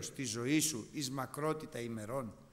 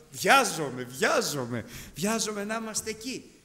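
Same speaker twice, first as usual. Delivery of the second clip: loud, eager repeated cry; can't wait to be there; eager longing; 4 semitones higher, 7 dB louder than usual. Retold or imitated. Preacher retells telling the Lord he longs for the rapture, eager and loud. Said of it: "Πω, πω, λέω".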